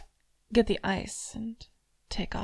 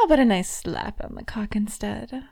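sudden sharp louder taunt; loud tease; 2 semitones higher, 6 dB louder than usual. Acted. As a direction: snap it out playfully.

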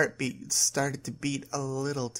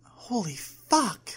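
slow, higher-pitched amazed exclamation; awestruck thought; 7 semitones higher, 3 dB louder, much slower than usual. A narrator's reading. Girlfriend thinking to herself at the ripping sound, amazed. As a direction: draw it out in amazement. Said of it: "Lisa thought".

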